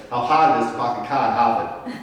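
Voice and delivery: imitated Boston accent, every R dropped. Teacher quoting a Boston tour guide, imitated Boston accent. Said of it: "Dropped every R in the sentence".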